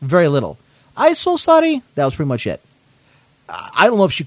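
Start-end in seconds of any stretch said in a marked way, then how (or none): 1.0-1.8 lightly voiced high, apologetic "I'm so sorry"; retold apology. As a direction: mimic her high little apology. Retold apology of non-English speaker.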